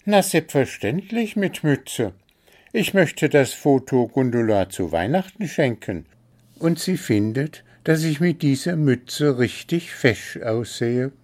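high-pitched bird character, sure of itself; a small bird explaining, pitched high; self-assured little bird. A narrator's reading. Story figure, little bird. (hohe, selbstbewusste Vogelstimme)

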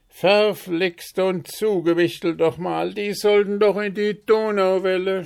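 loud, high-pitched cry of horror; horrified scream. A narrator's reading. Puh spotting the cherries meant for Donauwelle, horrified scream. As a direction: shout the curse in horror. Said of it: "schrie er entsetzt auf".

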